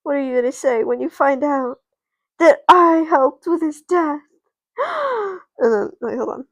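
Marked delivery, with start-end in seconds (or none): 0.0-4.2 building to a loud, high-pitched admission; dramatic confession. Acted. Loreline to friends, confessing her part, building outburst. 4.8-5.5 sharp, high mock gasp; shocked gasp. Friends hearing Loreline's confession, shocked gasp. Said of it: "Josh and Maya gasps".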